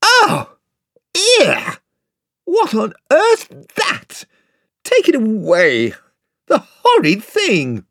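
high, loud, whining cry of fright; panicked outburst. Acted. Eustace shrieking at the mouse in fright. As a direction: squeal it like a spoilt frightened boy. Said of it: "Eustace burst out again".

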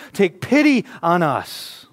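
slow, loud stretched plea; yelling out. Retold or imitated. Lepers yelling out to Jesus, slow loud plea. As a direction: draw out the cry for pity. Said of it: "they yell out to Jesus".

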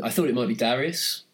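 ordinary talk

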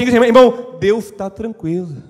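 preacher urging the church to tell each other; loud proclamation. Acted. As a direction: call it out to the congregation. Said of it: "diga ao seu irmão assim".

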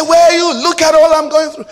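very high, loud calling out to God; insistent high-pitched pleading shout; acted desperate cry. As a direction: imitate a man wailing his complaint upward. Repeats man's cry to God.

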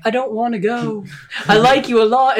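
lightly played needy creature; mock pleading monster. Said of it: "The thing's very clearly like".

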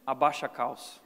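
a hint of a grim father; stern father order. Father ordering his son before a spanking, low. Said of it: "o pai falava aquelas palavras assim".

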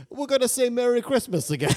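high, loud, blustering caricature; mocking a defiant politician; Trump impression. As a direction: belt it like a rally crowd-pleaser. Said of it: "he can also say".

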